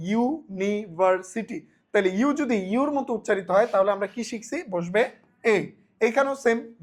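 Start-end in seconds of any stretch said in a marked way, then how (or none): 0.1-1.6 "ইউনিভার্সিটি" drawn out slowly with a you start; pronunciation demo. Shown as a demonstration. Teacher repeating university slowly for students. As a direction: stretch "ইউনিভার্সিটি" so the you sound shows. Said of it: "ইউর মতো উচ্চারণ করতেছি".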